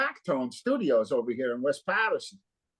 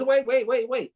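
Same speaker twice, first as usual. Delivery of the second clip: urgent call back; 9 semitones higher than usual. Retold or imitated. Friend calling him back urgently.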